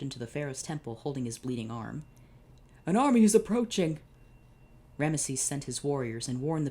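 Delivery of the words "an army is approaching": high, loud alarmed cry; a wounded guard shouting the warning; urgent shout. Acted. Wounded guard bursting into the temple, shouting an alarm.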